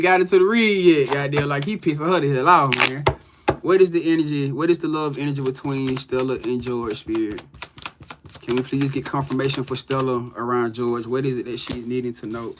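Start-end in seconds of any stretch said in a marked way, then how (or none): 3.6-12.6 softer, lower asking of the cards; tarot reading question. Acted. Reader asking spirit about Stella and George. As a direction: ask spirit the question calmly.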